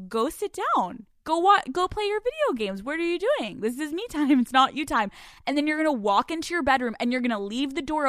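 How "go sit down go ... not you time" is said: higher, brisk mock dismissal; dismissive brush-off. Woman dismissing her boyfriend, mock bossy. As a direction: shoo him off to his games. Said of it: "you look at him and you go".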